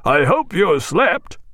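high, loud cheerful call, slowing at the end; captain hailing the doctor; loud hearty greeting. Acted. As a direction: tease the sleepy doctor loudly. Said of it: "cried Jack, very loud".

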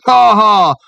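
wet, throaty burst of laughter; gurgling laugh. A narrator's reading. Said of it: "gurgled one of his".

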